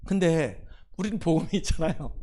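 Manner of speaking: ordinary talk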